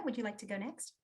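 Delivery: ordinary talk, nothing marked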